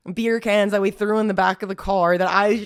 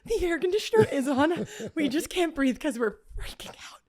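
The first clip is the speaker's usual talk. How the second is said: nervous laughing reply; 7 semitones higher, 5 dB quieter than usual. Retold or imitated. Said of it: "she was like".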